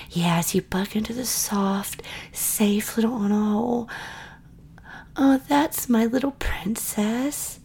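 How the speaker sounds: ordinary talk, nothing marked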